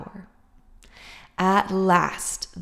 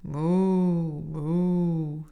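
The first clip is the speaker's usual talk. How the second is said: long drawn-out moo moo; cow mooing. A narrator's reading. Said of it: "said the spotted cow".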